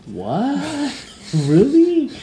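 surprised. Retold or imitated. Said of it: "I'm sitting there going".

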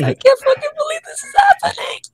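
high teary shriek of disbelief; ecstatic scream. Retold or imitated. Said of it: "I screamed so loudly".

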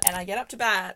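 ordinary delivery

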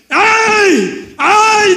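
high, drawn-out yells of "ay"; imitated shout. Preacher re-enacts joining the shouters, high imitated yells. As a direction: join in the yelling at full pitch. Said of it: "decía yo también".

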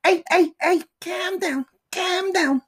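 Scouse catchphrase, hammed up high; Scouse impression. Teasing his Scouse partner with a high mock Liverpool accent. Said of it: "Leanne is a Scouse".

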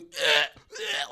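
mimicked choking retch; retold gagging. Friend retelling choking on a cookie, gagging. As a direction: gag loudly as if choking. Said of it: "I literally was going".

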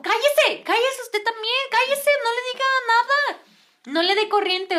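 high-pitched mock telling-off; scolding man. Man scolding the girl for answering back, high mock reprimand. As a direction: imitate someone urgently hushing. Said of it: "Me regañaba el señor".

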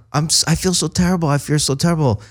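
high, quiet, fast guilty whine; mock remorse. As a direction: apologize like a shaken hippie. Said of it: "And he's like".

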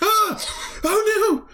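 put-on very high squealing child's scream; child terrified the soup talks; scared alien child. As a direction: shriek like a frightened little kid. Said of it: "she's like".

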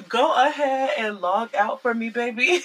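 laughing through a repeated brush-off; laughing dismissal. Real, not acted. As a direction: chuckle as you tell him to log off.

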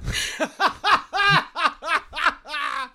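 long, high giggling laugh; helpless run of high laughter. Real, not acted. Laughing at the surprise scene.

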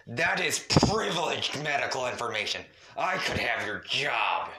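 loud, forceful snarl, slowing at the end; angry snarl. A narrator's reading. Ancestor threatens the doctor's job, snarling in anger. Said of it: "He snarls".

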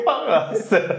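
high laugh into words. Real, not acted. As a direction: laugh while cursing. Laughing at song.

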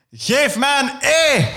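high, slow cheerleader call; rallying call. Acted. Yoshi calls to the crowd, high slow call. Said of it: "En ik roep".

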